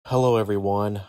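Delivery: normal talking voice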